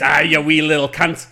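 loud Scottish insult, barked out; Scottish heavy shouting the line; Scottish accent. Acted. Pitching himself as the Scottish guy in the cast, loud Scottish accent. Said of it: "as a Scottish guy".

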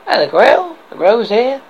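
a knight hungry for the Grail, asking eagerly; eager Arthur. Acted. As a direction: press the old man for the Grail. Arthur asks about the Grail.

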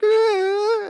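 loud, high drawn-out imitated wail; hammed-up high wail; mock whining wail. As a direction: imitate a drunk man's crying whine. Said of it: "like, whining, crying".

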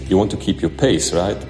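usual speaking voice

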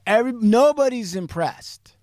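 high, loud, emphatic verdict. Real, not acted. Storyteller stressing the team's doubt, loud and emphatic. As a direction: punch it up high on NOBODY'S. Emphatic first take, then quiet repeat.

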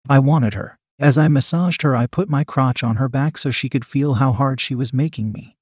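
ordinary delivery

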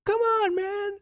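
squeaky high Sim voice; cartoonish high pleading Sim; weird accent. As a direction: pitch way up like a tiny game character pleading. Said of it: "I don't know why they have a weird accent".

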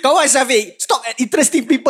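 high, loud plea; exasperated urging. Real, not acted. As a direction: loudly egg a friend on in exasperation. Urging friend during guessing game.

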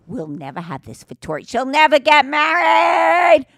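drawn-out wailing cry, loud and slow at the end; mock wail. Mother telling Daddy in a slow loud wail. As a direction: moan the last words like a grieving mother.

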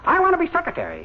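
timid little cuckoo squeak; piping cuckoo. Acted. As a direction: pipe up like a tiny bird. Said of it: "the little cuckoo piped up".

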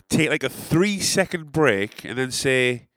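usual speaking voice

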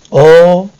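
a sharp, loud menacing "Or"; sudden loud threat. Acted. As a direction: snap it out as a threat.

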